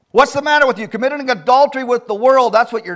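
loud, high preaching reproach; stern rebuke. Real, not acted. Preacher to congregation committing adultery with the world, stern rebuke. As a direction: take the listeners to task at full voice.

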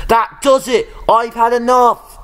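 Oliver finally snaps and cries out; frustrated cry. A narrator's reading. Little engine crying out that he has had enough. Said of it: "he cried".

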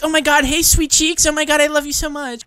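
high, sugary streamer imitation; mock gushing. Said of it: "You're like".

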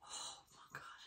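breathless whispered awe; awed whisper. Real, not acted. Medium sitting with Michael, whispering in stunned awe. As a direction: barely voice it, stunned.

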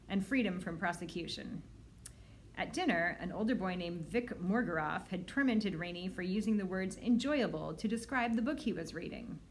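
ordinary delivery, nothing marked

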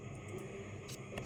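ordinary talk

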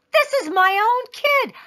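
high, indignant outburst; indignant protest. A narrator's reading. Father objects to paying for his kid, indignant.

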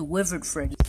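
ordinary delivery

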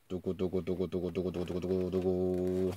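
soft, low, rapid drumroll mouth noise; quiet vocal drumroll. Pretend. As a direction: patter a quiet drumroll with the lips. Vocal drumroll before the reveal.

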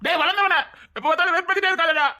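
high-pitched, exasperated scolding; fed-up high protest; irritated outburst. Acted. Tells friend to stop teasing.

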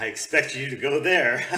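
loud mocking shout, breaking into laughter; shouted mock imitation of a spokesperson; mocking echo. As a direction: blast the quote back in mockery. Loudly mocks the clip's line.

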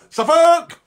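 high loud yell; shouting Suffolk. Acted. Host yelling Suffolk after slaving over the cooker, loud. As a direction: bellow the county name.

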